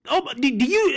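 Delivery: normal talking voice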